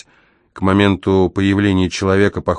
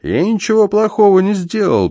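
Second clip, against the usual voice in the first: very high squeaky little voice; high-pitched rodent squeak; frightened squeak; 13 semitones higher than usual. A narrator's reading. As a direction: squeal in protest. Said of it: "пискнул Пака".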